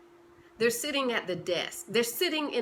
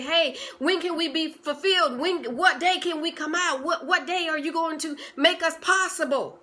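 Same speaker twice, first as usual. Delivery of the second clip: eager dreams; 6 semitones higher, 5 dB louder than usual. Pretend. Dreams whispering in your dream.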